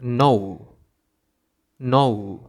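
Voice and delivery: "নৌ" starting straight on n; silent G. Shown as a demonstration. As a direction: say gnaw as "নৌ", no g. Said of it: "সেটার সঠিক উচ্চারণ হবে".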